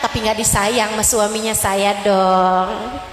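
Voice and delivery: low, smug voice of a self-satisfied wife, slowing at the end; mock a wife bragging about her marriage; boastful proud wife. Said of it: "dia ngerasanya". (istri sombong yang membanggakan diri)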